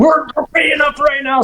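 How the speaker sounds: high mock shout; adamant client. Client refusing to wait, high mock insistence. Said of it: "adamant about it".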